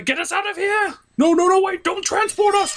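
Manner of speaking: high, frantic pleading; panicked high voice. Acted. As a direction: beg them in a panic. Toy characters panic.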